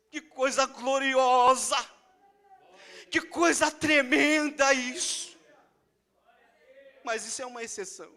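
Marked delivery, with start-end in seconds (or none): 0.1-5.4 awed exclamation. Retold or imitated. Preacher exclaiming in awe.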